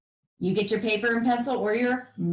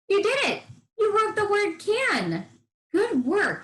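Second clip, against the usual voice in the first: high, beaming praise; excited praise; 10 semitones higher than usual. Acted. Teacher praising young writers for spelling can. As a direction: cheer the kids on. Praising child after correct word.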